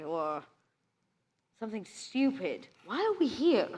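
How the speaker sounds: usual speaking voice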